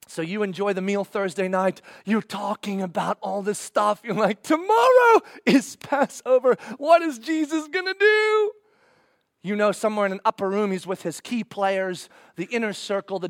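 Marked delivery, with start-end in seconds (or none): 4.4-8.5 high, loud, drawn-out eager cry; excited anticipation. Acted. Believer the night before Passover, wildly eager. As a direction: burst with anticipation, voice way up.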